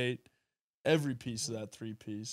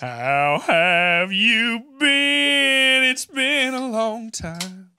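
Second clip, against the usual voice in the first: slow, high, breathy crooner greeting; breathy vintage purr; [name]; 7 semitones higher, 9 dB louder, much slower than usual. Retold or imitated. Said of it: "[name]".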